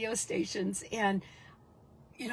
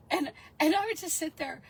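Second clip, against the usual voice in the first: ordinary delivery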